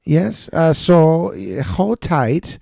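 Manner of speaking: put-on high, loud German pilot accent; German captain on the intercom, slowly. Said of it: "captain was German".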